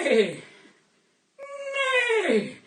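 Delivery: loud comic horse neigh; whinny it like a silly horse; hammed-up neigh; horse impression. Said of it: "my five-ball impression is of a juggling horse".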